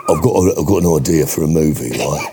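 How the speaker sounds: low-pitched, matey Cockney pitch; Cockney film director. Acted. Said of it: "Can you do your Cockney film director?".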